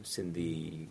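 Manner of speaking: ordinary talk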